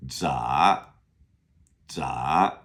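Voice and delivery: 杂 lifted in a rising tone; second tone. Shown as a demonstration. Teacher asking what tone is that, rising second tone. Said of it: "Second tone".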